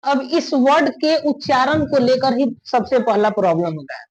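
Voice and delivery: normal talking voice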